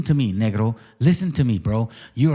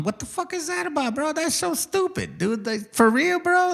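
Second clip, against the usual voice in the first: mock incredulous; 11 semitones higher than usual. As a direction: squeal it in mock disbelief. Mocking the caller's answer.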